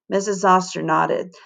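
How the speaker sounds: usual speaking voice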